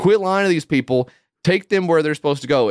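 hammed-up angry scolding of the driver; mock aggressive attendant. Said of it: "so aggressive".

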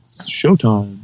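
slow showman affectation; big showman voice, drawn out. Shown as a demonstration. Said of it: "I do the big affectation for the show".

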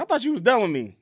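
mock disbelief. Blair confronting Chuck, acted out.

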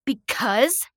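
loud, high, incredulous question; spat back. A narrator's reading. Outraged victim echoing the being's answer, spitting it. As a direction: spit the word back as a question. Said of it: "I spat at him".

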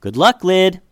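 called out loud across the yard; a friend hollering after Liddy as she leaves; hollered. Acted. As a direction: holler a cheerful goodbye. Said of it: "Jessica hollered out to Liddy".

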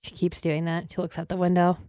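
ordinary delivery

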